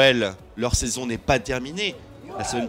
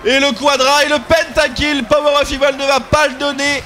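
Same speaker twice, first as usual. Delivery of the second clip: esports caster; 10 semitones higher, 7 dB louder than usual. Real, not acted. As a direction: hype play-by-play building to shouting. Live play-by-play of a pentakill.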